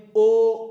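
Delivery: ओ held long and slow; long drawn o. Shown as a demonstration. Teacher drawing out ओ as long vowel. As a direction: stretch ओ out fully. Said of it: "थोड़ा लंबा खींचना होगा".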